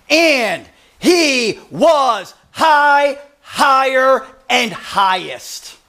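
slow, weighty pulpit proclamation; emphatic preaching. Acted. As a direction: preach it with each word drawn out and spaced. Preacher proclaims the vision at full force.

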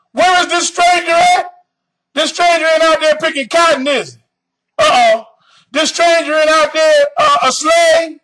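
high-pitched preaching challenge; fiery preaching. Acted. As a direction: press the crowd hard.

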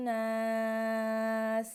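নাস with the ন held long and nasal; nasal ghunnah. Shown as a demonstration. Teacher shows pupils the ghunnah on নাস, long and nasal. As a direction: hum the ন of নাস through the nose, drawn out slowly. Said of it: "এখানে ওয়াজিব গুণা হবে".

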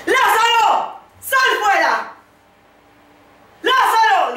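high, loud call across a distance; loud commanding call. Retold or imitated. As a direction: call the dead man out with full force. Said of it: "Gritó con todas sus fuerzas".

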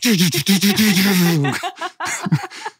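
imitating the driver with a high, breathy laugh; a quick mock laugh from the driver; driver's laugh. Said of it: "The driver's just like".

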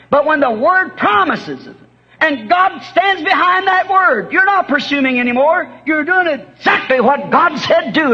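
high, ringing pulpit cry; soaring revival preacher's climb; fiery preaching. Acted. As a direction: preach it up to the rafters. Preaching climax, pitch way up.

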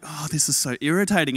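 low, soft grumble under the breath; annoyed mutter. Retold or imitated. Stuck in a long petrol queue, softly muttering irritation. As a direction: sigh it out to yourself, irritated. Annoyed thoughts waiting in line.

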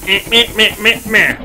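sing-song meh meh taunt; mocking taunt. Real, not acted. Taunting after spray painting.

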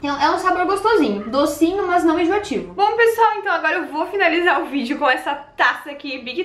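muffled behind hand; speaking while tasting; hand over mouth. Real, not acted. Seen 4: Straw at lips only at +0.1; then hand covers mouth while tasting.

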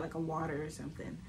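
ordinary delivery

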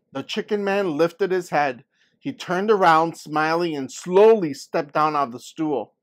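put-on lively read-aloud for children; story narration. A narrator's reading. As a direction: tell the story to the class with energy. Reads story aloud to class.

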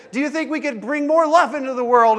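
put-on high, loud call to the kids; rallying question. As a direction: fire up the crowd. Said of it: "And I'd say".

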